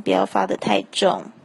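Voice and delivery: ordinary talk, nothing marked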